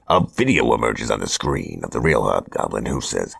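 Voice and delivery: usual speaking voice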